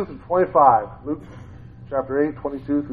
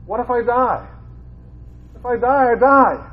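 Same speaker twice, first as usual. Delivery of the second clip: fervent preaching; 8 semitones higher than usual. Real, not acted. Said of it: "preaching at full force".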